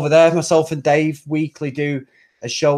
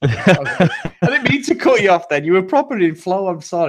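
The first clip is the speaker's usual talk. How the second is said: laughing; 4 semitones higher than usual. Real, not acted. Laughing apology for interrupting.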